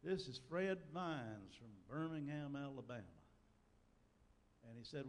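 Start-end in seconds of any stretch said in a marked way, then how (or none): 0.0-3.0 put-on Southern accent, words stretched; Fred Vines. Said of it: "in his southern accent".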